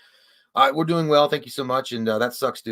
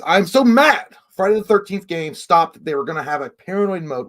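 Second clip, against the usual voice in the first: hammed-up mad read; 6 semitones higher, 8 dB louder than usual. Shown as a demonstration. Streamer hams up a viewer's angry chat message, mock mad. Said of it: "What are you saying here? Hold on, let me say".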